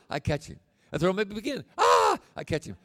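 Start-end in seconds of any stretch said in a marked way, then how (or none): 1.8-2.2 put-on high delighted squeal of a tossed child; child's squeal. Grandfather imitating grandson tossed in the air, high squeal.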